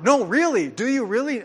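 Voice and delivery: high, loud astonished disbelief; dumbfounded. Retold or imitated. Mall evangelist stunned that the teen is already saved. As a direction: sound floored by the answer. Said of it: "he was just dumbfounded".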